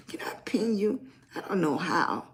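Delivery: Can I pin you, whispered off to the side; whispered aside. Real, not acted. Host asking a viewer about pinning, whispered. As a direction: drop to a whisper for a quick aside. Aside while managing the livestream.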